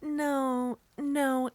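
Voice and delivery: usual speaking voice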